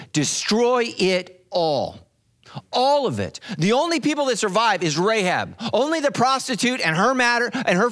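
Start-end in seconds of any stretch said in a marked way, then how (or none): 0.1-2.0 each word slow and heavy, God's decree; slow commanding God voice. Acted. Pastor voicing God's command to destroy Jericho, slow and grave. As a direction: lay it down slowly like a final order. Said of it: "He said".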